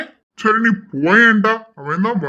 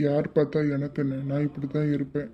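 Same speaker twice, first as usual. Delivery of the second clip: much softer and lower, stubborn murmur; hushed defiance; 4 semitones lower, 10 dB quieter than usual. Acted. Husband refusing to move away from his wife, hushed and stubborn. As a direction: dig in quietly, teasing.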